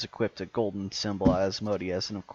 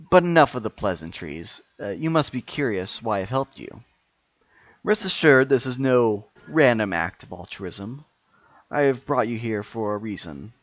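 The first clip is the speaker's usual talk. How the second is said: louder, grand villain speech; booming echoing villain monologue; 3 dB louder than usual. Acted.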